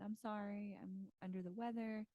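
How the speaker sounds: usual speaking voice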